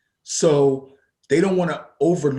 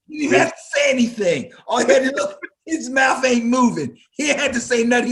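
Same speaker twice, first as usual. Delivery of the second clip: high, loud, fast words through laughter; shouting and laughing at once; laughing loudly; 12 semitones higher, 5 dB louder, faster than usual. Real, not acted. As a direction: crack up while telling it. Laughing, excited about the taunt.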